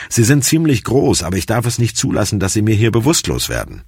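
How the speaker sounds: lightly voiced medic explaining; apologetic paramedic. A narrator's reading.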